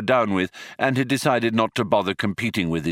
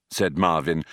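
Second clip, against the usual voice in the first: plain reading voice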